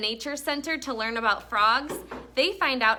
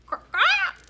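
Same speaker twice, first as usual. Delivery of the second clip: high squeaky croak, louder; frog croak; 6 dB louder than usual. Retold or imitated. Teacher imitating a frog call for the class, high croak.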